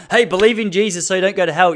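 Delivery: a hint of a zealous evangelist; mock preacher. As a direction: imitate a pushy preacher lightly. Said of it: "fundamental religious teacher coming up to you".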